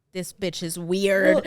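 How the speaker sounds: slow snide mock voice; mock snide girls. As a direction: sneer it slowly. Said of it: "everyone was like".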